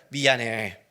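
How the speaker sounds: low, fuller-voiced careful apology; painstakingly earnest apology. Preacher apologizing again with all his heart, painstakingly earnest. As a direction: put exaggerated care into saying sorry. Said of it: "정성을 다해서".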